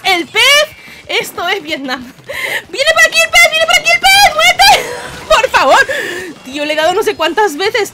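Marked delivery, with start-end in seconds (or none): 2.0-5.9 high, loud panicked yells; frantic laughing screams; frantic shouting. Real, not acted. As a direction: scream as the fish comes. Frantic fight over the fish.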